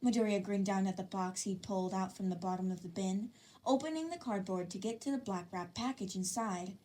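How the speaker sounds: plain reading voice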